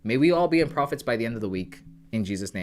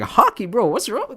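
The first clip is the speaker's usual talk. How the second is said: high, loud incredulous squeal; mock surprise; 10 semitones higher, 10 dB louder, slower than usual. Retelling his own shock to the kids, hammed-up high disbelief. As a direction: act baffled that they don't play hockey.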